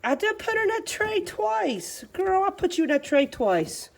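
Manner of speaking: high-pitched exasperated protest; mock scolding. Real, not acted. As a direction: fuss at the puppy, starting high and easing down. Fussing at puppy that peed.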